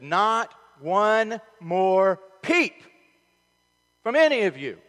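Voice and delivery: slow, loud military father warning; stern father yelling. Retold or imitated. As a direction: bark a final warning at the kids. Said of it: "And Tom, my father-in-law, said".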